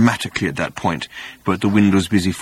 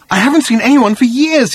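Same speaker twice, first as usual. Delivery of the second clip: high, louder, emotional outburst; overwhelmed, bewildered; 13 semitones higher, 5 dB louder than usual. A narrator's reading. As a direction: pour it out in bewildered agitation. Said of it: "sudden access of emotion and bewilderment".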